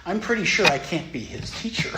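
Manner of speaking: sarcastic disbelief at a Dharma talk; incredulous retort. Retold or imitated. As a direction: scoff at the idea like it's absurd. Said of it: "I'm like".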